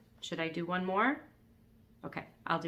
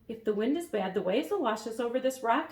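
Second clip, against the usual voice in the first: put-on higher worried boy voice; 4 semitones higher, 5 dB louder than usual. A narrator's reading. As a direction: voice Elmer a little higher. Elmer voiced.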